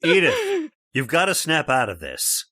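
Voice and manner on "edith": sharp call. Acted. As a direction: call her name out much higher and louder. Father shaking his hysterical daughter.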